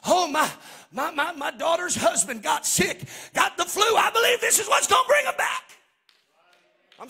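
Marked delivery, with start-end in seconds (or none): 0.0-5.6 a mom's plea rising into an excited shout; mock the caller building from plea to high shout; mimicking a hopeful mom. Pastor imitating a church mom who calls him, pleading then shouting. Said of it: "I had a mom call me and say".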